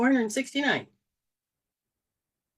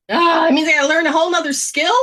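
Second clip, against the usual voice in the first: louder, fast exasperated protest; dismayed outburst; 6 semitones higher, 6 dB louder, faster than usual. Retold or imitated. Child dismayed by having to learn more, loud and fast. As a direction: complain loudly at the unfairness.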